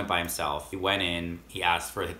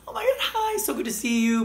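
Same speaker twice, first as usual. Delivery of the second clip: much higher, louder gushing hello; squealing delighted welcome; excited greeting; 6 dB louder than usual. Retold or imitated. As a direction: gush over an old friend you're thrilled to see. Saleswoman's excited greeting retold.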